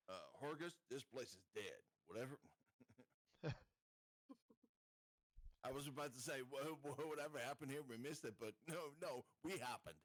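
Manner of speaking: ordinary delivery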